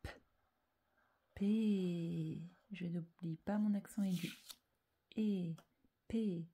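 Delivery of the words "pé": "P." held long as one unbroken syllable; drawn-out syllable. Shown as a demonstration. Teacher saying it while writing, drawn-out syllable. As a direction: stretch "P." out slowly on its vowel. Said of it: "donc je ne m'arrête pas".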